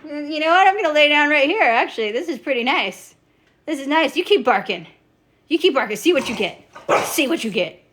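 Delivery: high cocky mock taunt; cocky pet voice. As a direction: tease the barking dog, smug and high. Voicing animal taunting barking dog.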